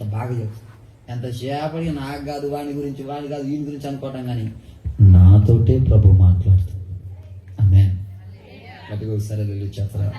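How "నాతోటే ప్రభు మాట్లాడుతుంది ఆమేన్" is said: loud declaration. Real, not acted. Preacher declares to congregation.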